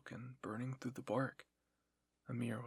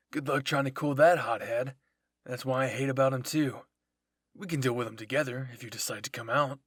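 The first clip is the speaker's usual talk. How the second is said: taunting; 10 dB louder than usual. Acted. Jean taunting, calling out.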